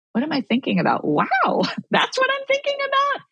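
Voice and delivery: high, amused surprise at her own thoughts; high surprised inner voice, a little laugh; mock surprise at oneself. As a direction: catch yourself off guard, laughing lightly. Self-talk, surprised at own thoughts.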